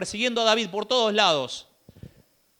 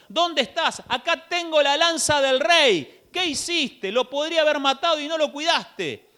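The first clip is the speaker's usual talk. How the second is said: higher, taunting call across the valley; shouting taunt; 6 semitones higher than usual. Acted. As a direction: yell down at the general, mocking. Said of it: "empieza a gritar". (David grita desde la montaña al general, burlón)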